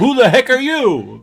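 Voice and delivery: loud, high, hammed-up demand; cartoonish outraged yell; indignant mock shout. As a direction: shout it like a startled comic-book character. Acted outburst of startled comic character.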